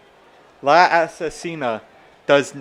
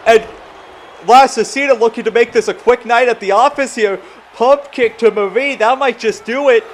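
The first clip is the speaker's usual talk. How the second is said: higher, louder rising play-by-play; excited call; 8 semitones higher, 8 dB louder than usual. Acted. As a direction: build to a near fall. Exciting near fall call.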